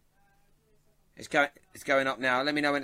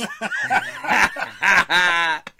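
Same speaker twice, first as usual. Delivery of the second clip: loud, long belly laugh; real laughter; 7 dB louder than usual. Real, not acted. Host teased by a viewer's comment, laughing out loud. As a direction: burst out laughing.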